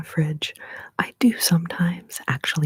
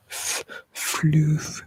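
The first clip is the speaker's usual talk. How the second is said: usual speaking voice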